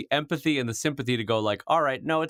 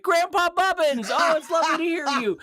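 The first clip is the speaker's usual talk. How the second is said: sweet grandchild voice. Pretend. Faking a call from Grandpa Bubbins, high and sugary. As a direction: coo sweetly at a pretend grandpa. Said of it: "you'd be like".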